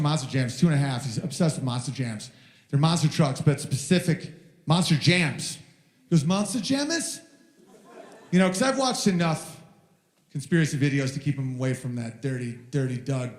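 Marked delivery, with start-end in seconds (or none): 6.2-7.2 put-on much higher little kid asking; curious toddler question. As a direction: ask it like a two-year-old. Son's question.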